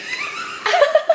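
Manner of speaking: squealing laugh; real high giggle; high shriek of laughter. Real, not acted. Laughing at ugly man.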